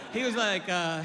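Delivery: usual speaking voice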